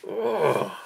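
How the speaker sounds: high shuddering "oh"; skin-crawling groan, pitched right up; creeped-out groan. Real, not acted. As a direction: let out a groan of revulsion.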